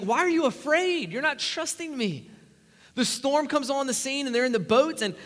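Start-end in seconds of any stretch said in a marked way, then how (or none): 0.0-2.3 raised, urgent questioning of the disciples; Jesus reassuring. Acted. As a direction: press them to trust. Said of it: "He's like".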